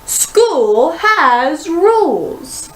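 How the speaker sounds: slow, high stern teacher. A narrator's reading. Teacher scolds class about rules, slow and high. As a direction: lay down the law slowly. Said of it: "shouted Miss Trisk".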